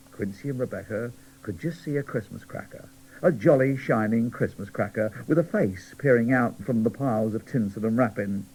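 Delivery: ordinary delivery, nothing marked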